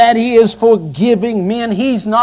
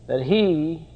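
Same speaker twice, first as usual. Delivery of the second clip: much softer, intimate preaching; hushed; 5 semitones lower, 10 dB quieter than usual. Real, not acted. Preacher confiding his awe to the congregation, hushed. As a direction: drop to a near whisper for them. Sermon drops to a hush.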